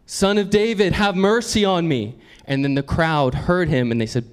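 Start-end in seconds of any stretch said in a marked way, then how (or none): 0.1-2.1 higher-pitched plea, calling out; crying out. Acted. Blind man begging Jesus from the roadside, crying out. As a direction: call out a plea for mercy. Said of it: "he began to cry out".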